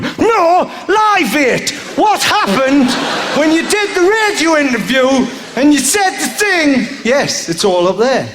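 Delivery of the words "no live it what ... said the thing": raised, higher pitched heckle from the crowd; calling out no, live it; mock heckle. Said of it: "some of you are going".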